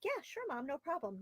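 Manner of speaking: high, sing-song "yeah sure Mom"; mock-sweet child voice; cheery compliant kid. Kid's ideal compliant reply.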